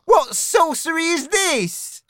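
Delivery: spluttering dog. A narrator's reading. Dog confronted with the cat's record, spluttering. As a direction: demand it as the appalled dog. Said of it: "he spluttered".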